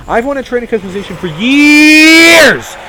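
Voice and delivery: loud, slow, high exasperated yell; frustrated shout. Real, not acted. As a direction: bellow the complaint out. Ranting about missing feature.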